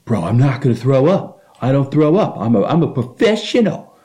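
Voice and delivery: high-pitched mock drunken insistence; drunk friend's protest. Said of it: "And he's like".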